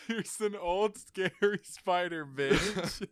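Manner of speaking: cracking up while repeating; laughing repeat. Real, not acted. Friend repeats the joke, laughing hard.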